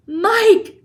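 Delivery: high loud scream of a name; clutching her head, screaming. A narrator's reading. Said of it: "screamed Missus T V".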